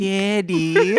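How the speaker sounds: high, whiny put-on baby voice; attempted baby voice. Host reads own note aloud, in whiny baby voice. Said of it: "It was whiny, I think, more than baby".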